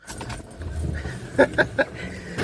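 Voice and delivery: a tired, airless laugh; a weary laugh at himself; breathy laugh. Real, not acted. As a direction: laugh it off, worn out. Tired laugh after I don't know.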